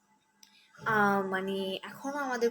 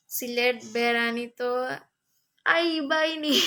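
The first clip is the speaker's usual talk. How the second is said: a reading in Sylheti dialect with a raised finish; Sylheti accent; 4 dB louder, slower than usual. Retold or imitated. Reading a viewer's Sylheti comment aloud to her live audience, in a Sylheti accent. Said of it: "আপনি সিলেটি ভাষায় কথা বলতেছেন".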